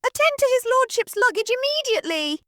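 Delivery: very high, shrill-pitched commanding matron; imperious mistress. Acted. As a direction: order the maid about from on high. Mistress orders maid.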